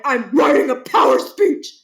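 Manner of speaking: a hint of a gruff, self-important principal. Barkin brushing off caller.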